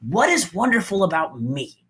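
open, curious asking; light, upbeat tone; hopeful self-question. Shown as a demonstration. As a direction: wonder about yourself with confidence. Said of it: "Do you hear the difference in my tone of voice".

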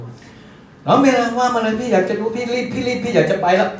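hurried, impatient fast talk then an eager pitch; rushed fast talker. Shown as a demonstration. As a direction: play a boss in a rush to leave. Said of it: "ถ้าเขาเป็นคนพูดเร็ว เราพูดช้ามันจะมีปัญหา". (พูดเร็วรีบร้อน)

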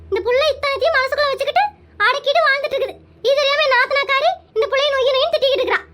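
high, loud village aunt complaining bitterly; a fired-up aunt venting about the family; grumbling Muppatha. Acted. Muppatha griping to her sister about Madhu's mother-in-law, loud and high.

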